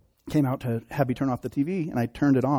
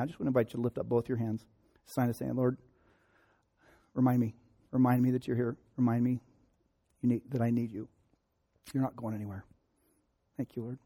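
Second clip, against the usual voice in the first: softer, quiet prayer invitation; hushed prayer; 5 dB quieter than usual. Acted. Pastor guides congregation in hushed prayer. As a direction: draw the people gently into prayer.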